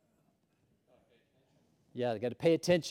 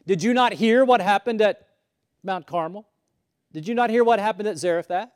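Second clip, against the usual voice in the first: indignant; 5 semitones higher, 7 dB louder than usual. Retold or imitated. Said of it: "He's like".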